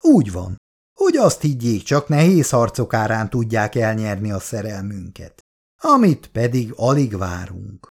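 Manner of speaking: ordinary delivery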